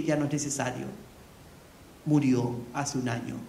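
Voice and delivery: low, soft, heavy retelling; quiet sad reply. Retold or imitated. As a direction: let the sad news drop quietly. Respuesta triste sobre una muerte. (respuesta baja y triste, casi en voz queda)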